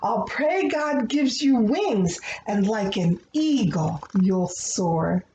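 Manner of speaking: gentle, rhythmic picture-book reading; reading to children. Acted. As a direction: read the rhyming prayer like a bedtime story. Reads a rhyming prayer book aloud.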